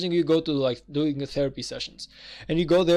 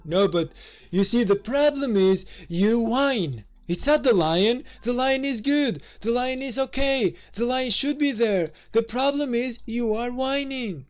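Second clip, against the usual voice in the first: higher, slow, lightly voiced dismissive girlfriend; mock girlfriend; 7 semitones higher than usual. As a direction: play her as a lightly mocking, slow know-it-all. Mimics the girlfriend's dismissive reply.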